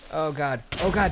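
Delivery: oh god, it's all gone; real dread. Real, not acted. Discovers lost gear.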